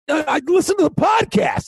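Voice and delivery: high, mock exasperated reply; exasperated retort. Host retorts to a fan, high and mock exasperated. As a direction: throw it back, fed up. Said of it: "And I'm like".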